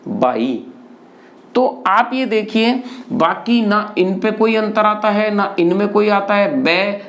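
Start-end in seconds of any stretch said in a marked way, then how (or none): 0.1-0.6 बाई with plain b and low pitch; Haryanvi बाई. Shown as a demonstration. Teacher showing the Haryana form of भाई, said low. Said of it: "वहाँ उच्चारण क्या है?".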